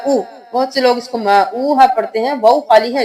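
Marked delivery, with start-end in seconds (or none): none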